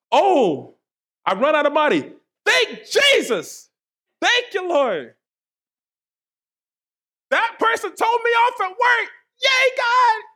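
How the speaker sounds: absurdly gleeful, high-pitched praise; hammed-up joy, climbing into a high cheer; mock cheerful. Preacher mimicking someone thanking God through a trial, mock cheerful. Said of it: "we don't wanna be cheerful".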